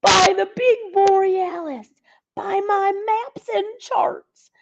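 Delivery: high, lively Santa voice full of wonder; a delighted old Santa marvelling; jolly Santa. Acted. Santa speaks in held voice.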